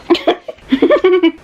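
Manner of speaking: high burst of giggling; hilarity. Real, not acted. Reader finding the chapter hilarious, high giggle. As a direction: crack up, pitched high. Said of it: "that's kind of fucking hilarious".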